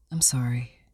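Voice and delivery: husband whispers sorry to his wife, ashamed; whispered apology. Acted.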